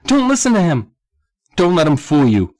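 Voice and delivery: high, loud urgent warning; emotional outcry. A narrator's reading. Sam warning against the old man, emotional outcry. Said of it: "Sam added with emotion".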